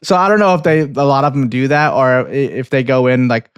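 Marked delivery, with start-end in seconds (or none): none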